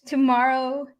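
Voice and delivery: ordinary talk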